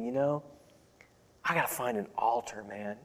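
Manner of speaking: inner thought. Retold or imitated. Preacher recalling his young self's hidden longing, softly inward. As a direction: say it to yourself under your breath.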